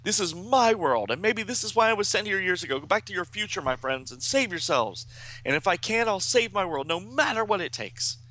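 high, impassioned comic-book speech; heroic Superboy. A narrator's reading. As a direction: declare it with rising determination. Acts Superboy's defiant farewell.

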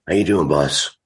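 rough, worn-out voice after shows; hoarse voice. Real, not acted. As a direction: talk through a hoarse throat. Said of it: "my apologies for sounding like a dirty old man".

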